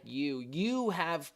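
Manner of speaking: YOU stretched out slowly with weight; emphatic YOU. Shown as a demonstration. Teacher showing the verse's emphatic pronoun, stressed YOU. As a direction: press on the word you and hold it. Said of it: "with an emphatic".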